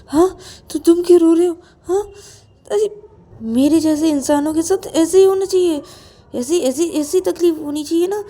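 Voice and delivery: loud, high agitated outburst; anguished self-blame. Acted. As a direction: blame yourself loudly, voice pitched high. Rahul's anguished self-blame in the story. (व्याकुल होकर ऊँची आवाज़ में खुद को कोसना)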